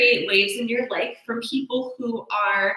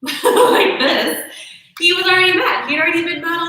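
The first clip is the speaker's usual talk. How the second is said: laughing; 9 semitones higher, 8 dB louder than usual. Real, not acted. Laughing while showing how she lay on the couch.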